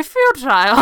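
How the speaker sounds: character voice. Pretend. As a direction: belt it out goofily on purpose. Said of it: "in a character voice".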